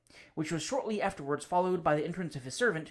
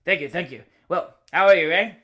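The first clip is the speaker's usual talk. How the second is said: hearty greeting, much louder; top of his voice; 11 dB louder than usual. Acted. Cousin bursts in on the bachelor, booming at full voice. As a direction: boom the greeting across the room. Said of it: "He always spoke at the top of his voice".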